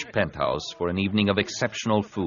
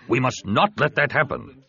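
firm, higher-pitched insistence; 6 semitones higher than usual. A narrator's reading. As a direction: put your foot down in a raised pitch. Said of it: "Ta said firmly".

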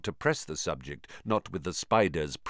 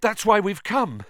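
put-on frightened boy; 12 semitones higher, 7 dB louder than usual. A narrator's reading. Harry's frightened voice.

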